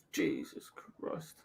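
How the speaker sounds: slow, very soft, almost whispered; hushed disbelief. Real, not acted. Streamer reacting to a near miss, hushed disbelief. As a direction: breathe it out low and slow, stunned. Muttered reaction after the shock.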